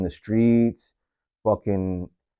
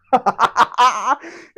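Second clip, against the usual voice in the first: laugh; 13 semitones higher than usual. Real, not acted. Ending laugh after twist.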